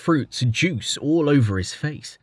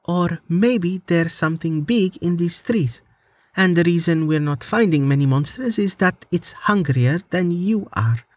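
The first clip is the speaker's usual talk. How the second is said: put-on higher, lighter young woman's voice; teasing young woman, higher pitched; Amelia's voice; 7 semitones higher than usual. A narrator's reading. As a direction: voice the heroine a step above your own.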